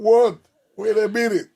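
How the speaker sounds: loud, high startled what. Retold or imitated. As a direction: jolt up, caught off guard. Startled DJ reacting to screams.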